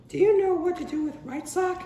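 a high-pitched mom at bedtime; mom's voice. Retold or imitated. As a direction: ask it like a bedtime mother.

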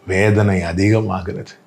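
normal talking voice

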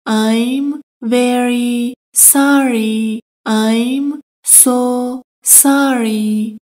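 slow, stretched teacher model; slowed-down drill. Shown as a demonstration. Teacher slowing the apology for Persian learners, stretched and slow. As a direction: spell it out slowly for learners. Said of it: "هر دو اصطلاح به معنی".

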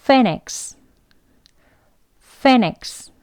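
"phoenix" starting on a clear F; teacher repeating "phoenix" twice; F sound demo. Shown as a demonstration. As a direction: model "phoenix" with ph said as F. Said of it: "F 발음입니다".

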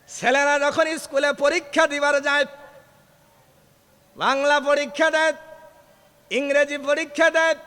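high, loud chanted sermon cry; preaching at full force. Acted.

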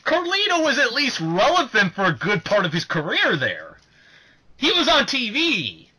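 high and loud insistence; heated defense. Real, not acted. Fan defending Carlito to co-host, high loud insistence. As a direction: push back hard for your favorite.

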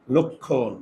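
teacher softly models লক্ষণ; pronunciation demo. Shown as a demonstration. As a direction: model লক্ষণ quietly as lokkhon. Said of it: "এইটার উচ্চারণটা হবে".

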